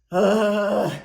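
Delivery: loud, fed-up groan; exasperated groan. Retold or imitated. Speaker groans after replaying her miserable meditation, exasperated. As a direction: groan it out in frustration.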